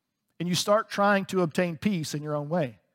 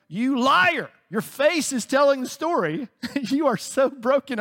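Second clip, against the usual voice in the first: calling out; 7 semitones higher, slower than usual. Real, not acted. Preacher calling out the congregation.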